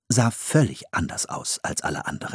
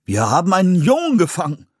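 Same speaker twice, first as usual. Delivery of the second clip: überrascht; 9 semitones higher, 4 dB louder than usual. A narrator's reading. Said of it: "stellte ein Mann überrascht fest".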